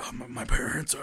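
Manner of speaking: hushed mock-shaken hero voice; Batman. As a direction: mumble it haltingly, as if just woken from a nightmare. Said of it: "And he's like".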